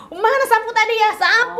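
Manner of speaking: high, loud mock scolding hunt for a broom; mock angry parent. Parent threatening child with a broom, mock angry shout. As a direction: ham up a furious parent grabbing the broom. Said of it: "Nggak perlu".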